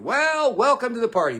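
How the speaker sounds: loud, high mock welcome; sarcastic welcome. Real, not acted. Mocking the commentator for catching up late, loud sarcasm.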